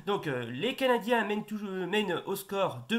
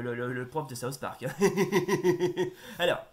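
ordinary talk, nothing marked

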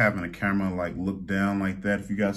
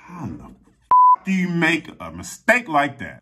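exasperated; 8 semitones higher, 6 dB louder than usual. Real, not acted. Fuming about the wrong store.

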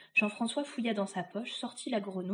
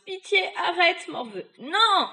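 loud, high, wailing plea; begging for mercy; 8 semitones higher, 8 dB louder than usual. Acted. Witch to the boy beating her, wailing for mercy. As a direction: plead desperately under the blows. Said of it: "Elle demanda grâce".

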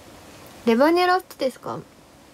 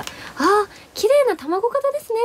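bright, higher sales-clerk voice, lightly done; imitating the shop clerk; 10 semitones higher, slower than usual. Clerk complimenting her egg-shaped face, bright higher clerk voice. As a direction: chirp it like a cheerful cosmetics clerk.

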